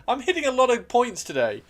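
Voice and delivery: high, loud outburst; excited exclamation. Real, not acted. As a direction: announce it loudly, pitched well up. Exclaims, then repeats it more calmly.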